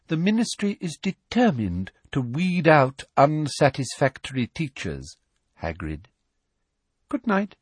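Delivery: high prim voice sinking soft on the name; sweetly menacing goodbye; soft Umbridge threat. A narrator's reading. Umbridge threatening Hagrid at the door, soft sweet menace. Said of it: "said Umbridge softly".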